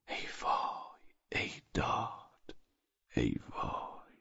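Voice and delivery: very slow, barely voiced lament; whispered dismay. Husband alone after accusing his friend, whispered dismay. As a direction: breathe each exclamation out, drawn long.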